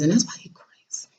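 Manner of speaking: a quiet confiding whisper; whispered aside. Real, not acted. Whispering to viewers that he is crazy. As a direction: drop to a whisper. Aside about ex's father.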